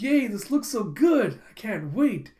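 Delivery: high, loud, drawn-out mock cheer; delighted. Child receives a share of the treat, delighted. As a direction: cheer over a treat, then settle.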